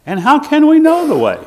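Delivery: high, loud pleading question. Retold or imitated. Thomas asking Jesus the way, a high loud plea. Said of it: "doubting Thomas".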